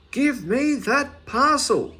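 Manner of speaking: high, slow bellowed demand; loud shout. A narrator's reading. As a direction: yell it after the fleeing man. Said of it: "shouting out very loudly".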